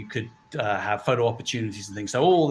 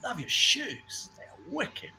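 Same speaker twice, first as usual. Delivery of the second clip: mock passerby; 6 semitones higher, 6 dB quieter, much slower than usual. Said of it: "I won't do the accent".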